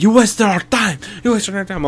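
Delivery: mock the furious caller's complaint; imitating angry scammer. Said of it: "They were getting so mad".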